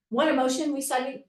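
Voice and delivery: ordinary talk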